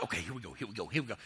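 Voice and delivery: soft, under-the-breath chant; hushed self-talk. Retold or imitated. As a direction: psych yourself up quietly. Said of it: "you're talking to yourself the whole time".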